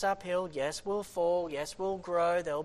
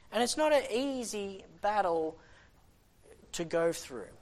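ordinary delivery

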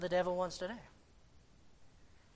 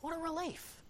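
soft relief; 10 semitones higher, 9 dB quieter than usual. Real, not acted. After sigh, relief.

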